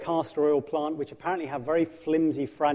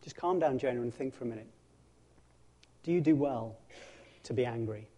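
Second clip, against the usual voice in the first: gentle God; 4 semitones lower, 9 dB quieter than usual. Acted. God gently reasoning with Jonah.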